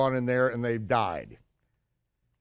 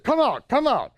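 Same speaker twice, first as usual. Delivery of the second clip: retold order; 10 semitones higher, 4 dB louder than usual. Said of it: "And they said".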